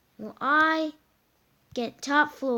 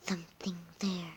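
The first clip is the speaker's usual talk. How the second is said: whispering; 5 semitones lower, 10 dB quieter than usual. Pretend. Hearing a noise, sneaking.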